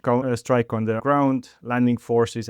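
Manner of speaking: ordinary talk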